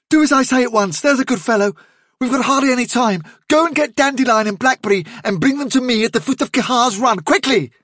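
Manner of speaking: high, pressing leader's orders; Hazel, urgently commanding. A narrator's reading. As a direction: rattle out orders with no time to lose.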